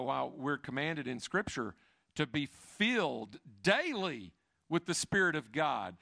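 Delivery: ordinary talk, nothing marked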